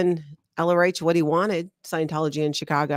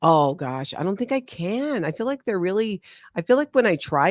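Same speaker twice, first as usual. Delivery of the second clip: ordinary delivery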